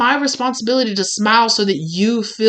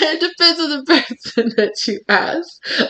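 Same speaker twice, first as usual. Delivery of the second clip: higher, words broken by laughter; laughing; 6 semitones higher than usual. Real, not acted. Qualifying her claim to be smiley, real laughing. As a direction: laugh through the admission.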